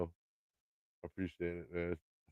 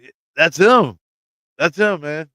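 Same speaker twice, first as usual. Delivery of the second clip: excited recognition; 5 semitones higher, 8 dB louder, slower than usual. Retold or imitated. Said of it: "I'm like".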